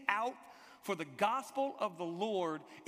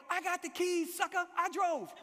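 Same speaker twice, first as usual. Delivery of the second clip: high, acted shouted comeback; defiant retort; 10 semitones higher than usual. Driver to his departing friends, shouting defiantly.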